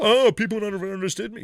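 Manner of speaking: high, loud mocking voice; mock complaint. Teasing over being misunderstood for years, mock complaint. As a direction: put on an exaggerated poor-me cry.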